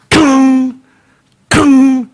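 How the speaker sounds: loud, high kung, kung like a mallet strike; imitating the mallet banging the nail, loud and high; hammer sound effect. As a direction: bang out each kung like a hammer. Mimicking hammer blows.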